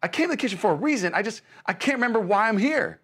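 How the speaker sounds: baffled man in the kitchen; confused self-quote. Retold or imitated. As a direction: act out forgetting why you walked in. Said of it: "I'm like".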